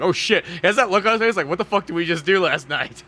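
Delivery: loud, high startled outburst; alarmed waking. Retold or imitated. Terry waking up from a dream, alarmed. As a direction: play a hammed-up shocked wake-up.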